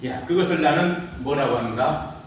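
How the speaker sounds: ordinary talk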